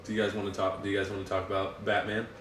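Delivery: usual speaking voice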